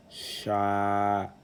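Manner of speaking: "sha" drawn out on a steady note; lengthened A vowel. Shown as a demonstration. Teacher rereading the word by syllables, long held "sha". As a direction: sustain the a of "sha". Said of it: "l'outil d'allongement à la voyelle A".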